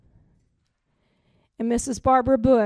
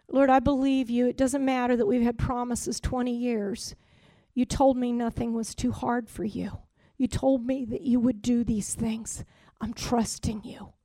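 much softer, intimate prayer of trust; hushed prayer; 2 semitones lower, 7 dB quieter than usual. Retold or imitated. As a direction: speak it to God softly, almost under the breath.

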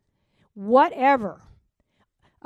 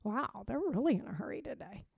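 mild amused remark; 9 dB quieter than usual. Retold or imitated. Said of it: "Now, I might say that".

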